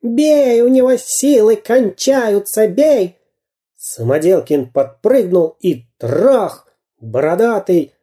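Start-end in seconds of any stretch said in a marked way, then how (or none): 0.0-3.2 higher, slow desperate yell; wailing spy. A narrator's reading. Dyrka lying on the pavement yells to beat Samodelkin, wailing. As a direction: howl from the pavement.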